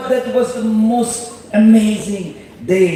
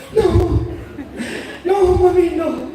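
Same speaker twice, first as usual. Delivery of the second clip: laughing protest; 7 semitones higher than usual. Retold or imitated.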